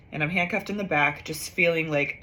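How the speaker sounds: normal talking voice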